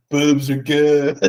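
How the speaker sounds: laughing through the words; giggling at his own joke. Real, not acted. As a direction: crack up while saying it. Laughing at himself.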